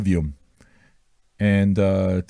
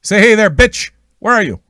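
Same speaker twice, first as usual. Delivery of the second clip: much higher, louder teasing call; high, loud playful shout-out; 9 semitones higher, 7 dB louder than usual. Pretend. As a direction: holler across the room for the patron. Calling out to absent patron.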